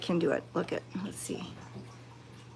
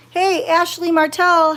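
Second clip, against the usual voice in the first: much louder and higher, a cheerful shout-out; calling out; 8 semitones higher, 9 dB louder than usual. Real, not acted. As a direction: call the name out brightly to the viewer. Calling out a birthday greeting.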